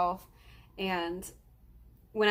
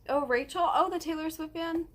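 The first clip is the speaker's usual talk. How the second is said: lightly voiced excited acquaintance, higher; mock others recognizing her, a higher pitched "oh"; people recognizing her; 8 semitones higher than usual. Imitating people who greet her, higher-pitched and eager. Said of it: "That's how everybody addresses me".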